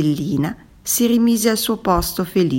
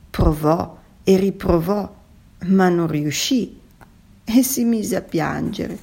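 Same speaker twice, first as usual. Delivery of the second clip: usual speaking voice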